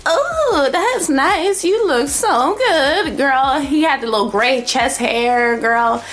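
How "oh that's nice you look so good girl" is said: high, slow mock admiration; hammed-up fake compliment; fake sweet praise. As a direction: gush insincerely. Fake compliment to old man.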